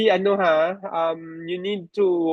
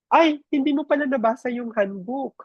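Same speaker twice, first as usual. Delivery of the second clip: higher-pitched mock surprise, dripping sarcasm; sarcastic HR jab, pitched up; 7 semitones higher than usual. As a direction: feign surprise to needle the employee.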